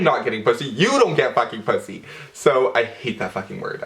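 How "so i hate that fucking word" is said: ordinary talk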